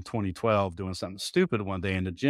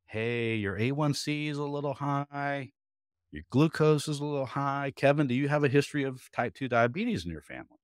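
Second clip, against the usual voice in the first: doctor voice; 5 semitones higher than usual. Retold or imitated. Voicing the doctor.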